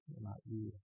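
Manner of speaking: very quiet, subdued repetition; soft echo. Shown as a demonstration. Preacher repeating a vow along with the congregation, very soft echo. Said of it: "And then repeat with me".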